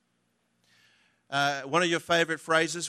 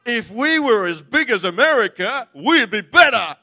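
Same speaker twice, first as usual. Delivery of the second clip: hammed-up Aussie excuse; mock competitive boast; 5 semitones higher, 11 dB louder than usual. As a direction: say it like a sore loser insisting. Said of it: "What you wanna say is".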